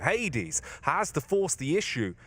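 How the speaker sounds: rising excitement, quick and high; esports caster. Real, not acted. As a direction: call the clutch like a caster. Tense clutch play-by-play.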